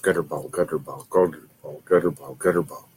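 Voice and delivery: low, quick, soft repeated mumbling; self-talk. Acted. Bowler psyching themselves out at the line, muttered self-talk. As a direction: mutter it to yourself like an anxious chant. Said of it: "you say to yourself".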